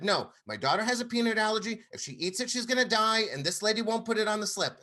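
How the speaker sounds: high, fast, urgent retold plea; frantic plea. Father begs the restaurant manager about the allergy, frantic and fast. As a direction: rattle it off in a panicked rush.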